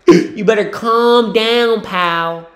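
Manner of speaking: slow, loud, high stern warning; mock stern warning. Real, not acted. Scolding a player on TV, slow stern mock warning. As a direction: warn him like a scolding parent.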